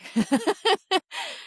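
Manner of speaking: high, breathy burst of laughter; relieved laugh. Real, not acted. Nurse laughing that she kept her job, high relieved laugh. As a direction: laugh off the scare. Relieved at not being fired.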